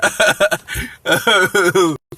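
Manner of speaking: laughter trailing off; laugh, then let it die down; amused laugh. Real, not acted. Amused by name in comment.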